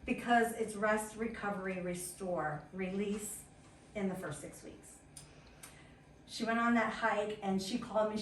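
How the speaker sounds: ordinary talk, nothing marked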